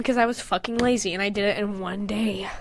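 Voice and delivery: sheepish whisper; whispered confession. Real, not acted. Confessing to her friend she drew it lazily. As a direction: admit it under your breath.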